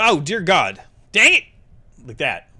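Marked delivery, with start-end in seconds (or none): 0.0-1.4 loud, frustrated yell; frustrated outburst. Real, not acted. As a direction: blurt it out in frustration. Game mishap, frustrated outburst.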